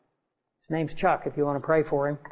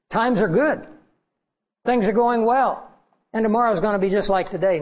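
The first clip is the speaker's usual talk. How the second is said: mock complacency; 3 semitones higher than usual. Preacher imitates people expecting tomorrow like today, mock complacency. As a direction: voice a lightly mocked rosy outlook.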